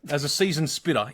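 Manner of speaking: ordinary talk, nothing marked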